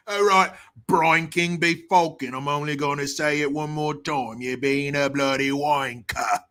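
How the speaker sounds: hammed-up Cockney telling-off; exaggerated put-on Cockney accent; mock Cockney. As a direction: scold in broad fake Cockney. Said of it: "the accent's called Cockney".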